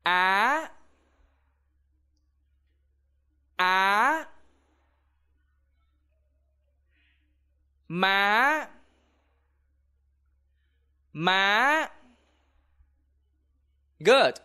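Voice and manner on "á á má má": á rising high and sharp at the end. Shown as a demonstration. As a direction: lift á upward like a question. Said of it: "repeat after me".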